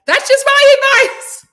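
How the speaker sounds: high, loud laughing repeat of the advice. Real, not acted. Laughing loudly to her live chat.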